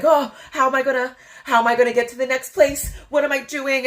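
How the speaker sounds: high, loud, rushed fretting; imitating herself stressing in a panic; mock panic. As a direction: act out spiralling anxiety. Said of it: "I'm, like, stressing".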